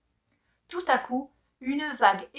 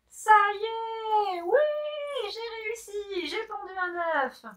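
triumphant joy; 11 semitones higher than usual. Acted. Triumph after laying.

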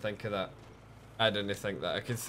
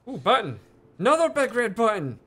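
loud, high-pitched excitement; excited discovery; 11 semitones higher, 9 dB louder than usual. Real, not acted. Player spotting another big red button, excited. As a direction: call it out like a thrilling find.

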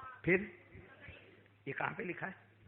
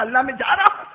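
acted alarm; 8 semitones higher, 11 dB louder than usual. Moses about to flee the snake.

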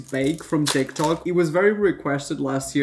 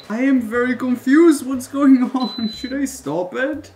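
baffled and rising; genuinely confused, voice up; 7 semitones higher, 5 dB louder than usual. Real, not acted. Confused reaction to failed recipe.

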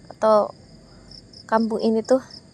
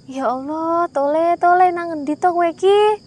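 panicked mother; 6 semitones higher than usual. Retold or imitated. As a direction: call out in panic for the boy. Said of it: "panik lagi".

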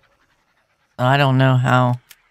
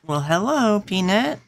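affectionate pet greeting; 8 semitones higher than usual. Real, not acted. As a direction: greet a beloved pet in a much higher voice. Greeting a pet or little one.

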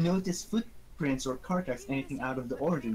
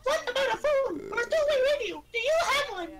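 put-on high girlish voice. Voicing Nancy Drew, very high.

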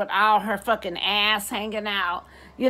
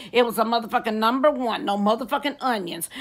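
usual speaking voice

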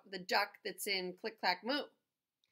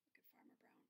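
whisper; 39 dB quieter, much faster than usual. Real, not acted. Whispered aside.

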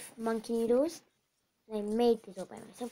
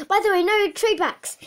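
gloating warning; 7 semitones higher, 10 dB louder than usual. Acted. Seller sealing the deal.

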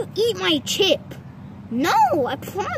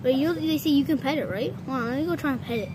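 ordinary talk, nothing marked

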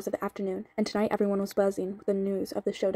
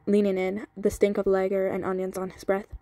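ordinary delivery

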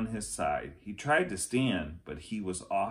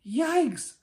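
high, loud sudden yelp; startled yelp; 6 dB louder than usual. A narrator's reading. Annie as the buggy lurches backward, startled yelp. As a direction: yelp in alarm.

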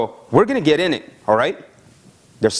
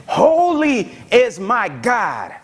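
slow, high, sung-out praise; slow, drawn-out cry of praise; worship call; 7 semitones higher, much slower than usual. Shown as a demonstration. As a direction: lift the words up as worship. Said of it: "this is a praise and worship call".

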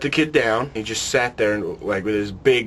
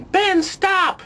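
high, loud call of a name; kids calling out; 3 dB louder than usual. Retold or imitated. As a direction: yell it like the whole playground. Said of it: "I heard everyone going".

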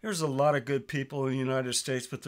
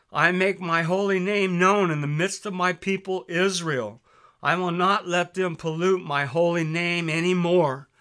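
raised, louder preaching read of the Bible; scripture reading; 4 semitones higher, 5 dB louder than usual. Acted. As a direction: proclaim the verses like a preacher.

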